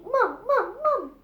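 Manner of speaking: put-on high babbling baby voice; toddler babbling. Molly babbling Mum at the kitchen table, high baby voice.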